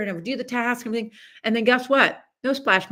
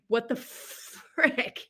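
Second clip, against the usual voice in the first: bewildered; 4 semitones higher, 7 dB quieter, much slower than usual. Retold or imitated. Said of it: "And I'm like".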